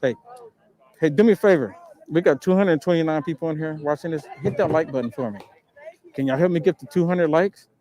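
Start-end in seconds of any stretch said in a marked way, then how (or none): none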